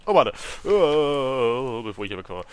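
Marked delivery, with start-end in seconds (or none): 0.6-1.8 loud, long "äh"; drawn-out groan. Real, not acted. Player interrupting himself mid-read, loud groan. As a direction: groan loudly, dragging it out.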